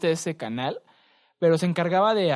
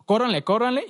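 raised, loud imitation of fleeing men; mock urgent warning; 7 semitones higher, 6 dB louder than usual. Retelling the video's runaways, loud mock warning. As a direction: call it out high and loud.

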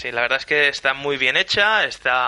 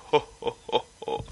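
a hint of a soft Santa ho-ho, distant; Santa laugh; 2 semitones lower, 7 dB quieter, slower than usual. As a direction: lightly voice a quiet Santa chuckle in the background. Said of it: "las risas de fondo de".

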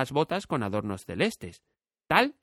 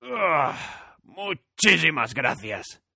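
slow, higher relieved thanks; grateful scarecrow; 4 semitones higher, much slower than usual. A narrator's reading. Freed scarecrow thanks Dorothy, relieved. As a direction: sigh with joy at freedom.

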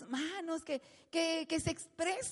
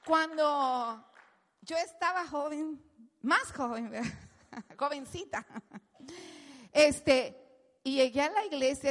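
ordinary delivery

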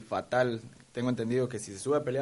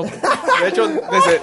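squealing laugh; 9 dB louder, much slower than usual. Acted. Laughing denial of accent.

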